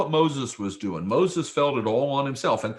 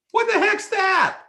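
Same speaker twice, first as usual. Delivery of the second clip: amused surprise. Real, not acted. Preacher amused by verse.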